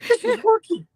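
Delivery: high, excited, laughing through it; thrilled. Retold or imitated. Caller reliving his phone-trick success, giddy and high. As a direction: replay the giddy moment the trick works.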